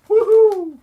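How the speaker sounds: very high, loud "woohoo"; whooped cheer. Acted. Reviewer punctuating his film list, high loud whoop. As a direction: let out a falsetto whoop.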